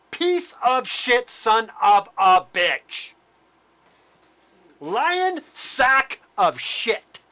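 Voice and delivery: furious name-calling. Real, not acted. Ranting vlogger cursing Fauci to viewers, slow furious insults. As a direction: drag out every insult in real rage.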